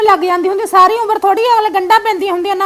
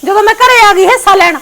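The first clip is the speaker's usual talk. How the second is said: loud sarcastic scolding; staged anger; 7 dB louder than usual. Pretend. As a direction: taunt her loudly for show. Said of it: "ਗੁੱਸੇ ਦਾ ਨਾਟਕ ਕਰ ਦੇ". (ਤਾਨ੍ਹੇ ਵਾਲਾ ਗੁੱਸਾ)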